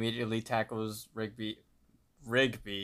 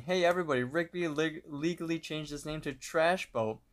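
higher-pitched mild mock yell; 6 semitones higher than usual. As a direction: call it out as if to everyone around. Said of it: "he runs off scene yelling".

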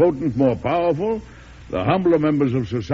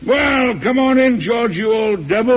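much higher and louder, booming welcome; hearty greeting; 8 semitones higher, 5 dB louder than usual. Acted. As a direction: call the old friend in with gusto. Calls out greeting to old friend.

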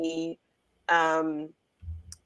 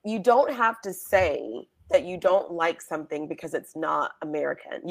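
ordinary delivery

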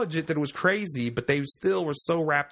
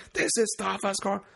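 higher mock war-cry delivery; King Leonidas; 7 semitones higher than usual. Announcing a listener's segment, mock kingly war cry. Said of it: "read this in, like, uh, you know, King Leonidas".

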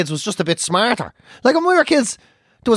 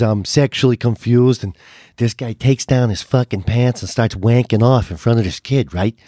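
a lightly voiced American comedian, lower; imitating the comedian; 8 semitones lower than usual. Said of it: "he starts going".

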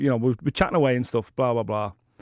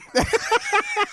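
high laugh. Real, not acted. Laughs at the pub image.

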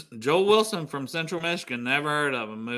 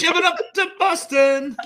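mock Boston accent; 11 semitones higher, 6 dB louder than usual. Teasing about a Boston accent.

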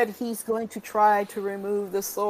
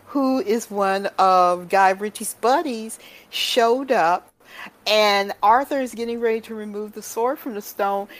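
ordinary talk

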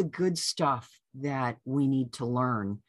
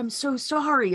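apologetic; 10 semitones higher, 3 dB louder than usual. Retold or imitated. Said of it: "I said".

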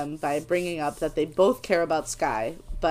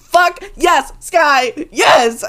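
loud, high whoops of approval; real triumphant shouting; excited cheering; 9 semitones higher, 10 dB louder, much slower than usual. Real, not acted. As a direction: cheer a hero on, punching each word. Cheering the character on.

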